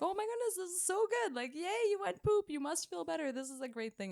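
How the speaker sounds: high, gushing encouragement, imitated; sing-song high praise, easing down at the end; smiling congratulations. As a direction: cheer the patient on in a high voice. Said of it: "laughing, smiling, but at the end being like".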